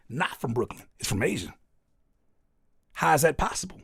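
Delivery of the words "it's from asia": usual speaking voice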